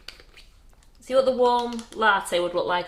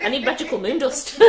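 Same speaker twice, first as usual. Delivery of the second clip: ordinary talk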